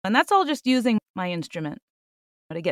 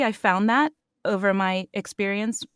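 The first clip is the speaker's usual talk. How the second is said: ordinary delivery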